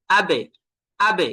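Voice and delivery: আবে with the closed b hit and released as an echo; struck qalqalah. Shown as a demonstration. Teacher gives an example of striking, b of আবে jolted. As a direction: punch out the b of আবে with a short bounce. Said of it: "আঘাত করে উচ্চারণ করা".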